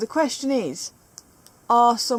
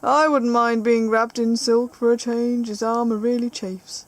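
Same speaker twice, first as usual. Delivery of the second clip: loud, slow declaiming; hammed-up character line, easing at the end; villain's quote; 6 dB louder than usual. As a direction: proclaim it as the evil mech. Reads evil mech's quote.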